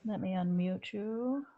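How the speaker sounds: ordinary delivery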